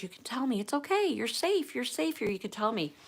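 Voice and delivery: high, gentle coaxing; reassuring old lady. Retold or imitated. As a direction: imitate an old lady soothing a frightened child. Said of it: "And she goes".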